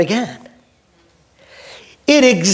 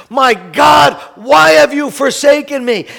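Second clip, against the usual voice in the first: crying out; 3 semitones higher, 5 dB louder than usual. Acted. Pastor voicing Jesus on the cross, anguished cry. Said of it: "Jesus crying out".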